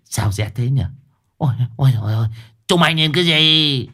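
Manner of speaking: mếu máo nhẹ; mild whimper. A narrator's reading. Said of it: "Lão mếu máo". (giọng mếu máo nhẹ của ông già)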